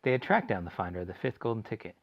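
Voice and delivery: ordinary delivery, nothing marked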